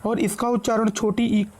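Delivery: usual speaking voice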